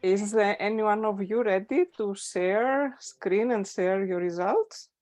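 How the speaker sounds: normal talking voice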